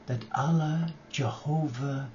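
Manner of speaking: usual speaking voice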